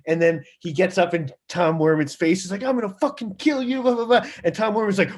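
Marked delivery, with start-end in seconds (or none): 2.6-4.3 much higher hammed-up threat; mock threat. Guitarist in the producer's face, hammed-up threat. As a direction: snarl it like a raging guitarist in someone's face.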